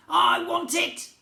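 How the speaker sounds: high, loud, slow spoilt wail; goblin tantrum. A narrator's reading. Goblin prince throwing a tantrum, high slow loud wail. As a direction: drag it out like a tantrum.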